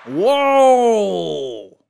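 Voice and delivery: loud drawn-out falling whoa; hammed-up astonished exclamation; overplayed game show gasp; big mock whoa. Host realizes contestant named Winner.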